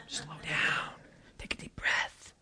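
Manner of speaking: hushed prompting from the back; soft unvoiced reminder; whispered cue. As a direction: whisper slow down, take a deep breath. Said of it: "[name] back there giving me cues".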